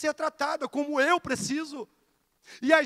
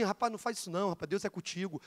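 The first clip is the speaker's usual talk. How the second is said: gentle advice; 5 semitones lower, 7 dB quieter, faster than usual. Acted. Conselho gentil de uma pessoa.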